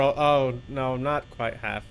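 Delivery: ordinary delivery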